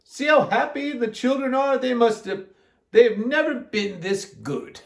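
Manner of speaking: high-pitched lady's character voice; Miss Ralph. A narrator's reading. Miss Ralph on the happy children, high voice. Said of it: "said Miss Ralph".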